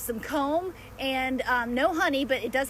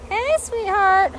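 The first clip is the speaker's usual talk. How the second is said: high, slow, cooing hello; sweet hello to a bee on her hand; tender greeting; 9 semitones higher, 9 dB louder, much slower than usual. Real, not acted.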